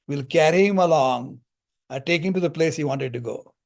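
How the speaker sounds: ordinary talk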